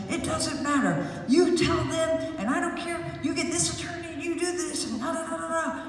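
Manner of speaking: high, fired-up orders; mock angry boss. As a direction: bark orders like a stubborn boss. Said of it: "And he said".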